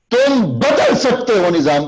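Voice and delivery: high, slow call to the listener; slow oratorical exhortation; rousing address. Shown as a demonstration. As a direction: speak straight to them and stir them. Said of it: "سامنے دیکھ کے بات کریں گے".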